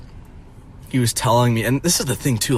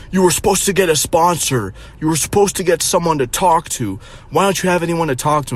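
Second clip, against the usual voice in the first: higher, nagging mom voice; mocking mom; 6 semitones higher than usual. Mocking his mom's scolding in the car, higher and nagging. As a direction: nag like a scolding mother.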